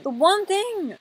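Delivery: high, loud dramatic lament; exasperated wail. Pretend. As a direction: wail it in mock despair. Repeats complaint as a wail.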